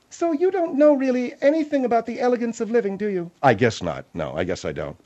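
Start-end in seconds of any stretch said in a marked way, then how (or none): 0.1-3.3 higher, condescending woman's voice; Margaret. Acted. Margaret questioning Phil about elegant living, condescending. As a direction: look down on him as a snooty lady.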